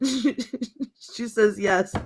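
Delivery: usual speaking voice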